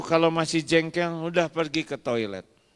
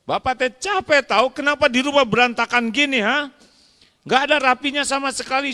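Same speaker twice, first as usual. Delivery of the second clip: higher and louder, rapid tirade; angry husband; 10 semitones higher, 3 dB louder than usual. Acted. Husband scolding wife over messy house, angry rant.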